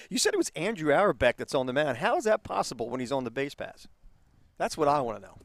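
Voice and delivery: ordinary delivery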